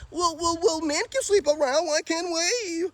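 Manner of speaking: high whiny mock female voice; hammed-up high nagging woman, dragging the last words. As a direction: mimic a whining woman arguing back. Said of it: "Ladies don't have trauma that they gotta play devil's advocate".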